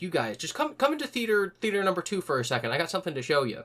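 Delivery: high, sing-song calling them into the theater; eager summons. Retold or imitated. Narrator luring her manager and friend into theater two. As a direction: coax them along with a bright lifted voice.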